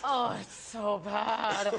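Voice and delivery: very slow mock groan; muttered despair. Actress mimics director watching her act, muttered despair. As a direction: drag it out as a miserable director. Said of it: "Under his breath".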